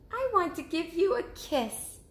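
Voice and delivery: high, small cub voice; little cub asking. Acted. Cub asking mother about sleeping outside, high little voice. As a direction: ask like a curious little bear cub. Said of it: "said Baby Bear".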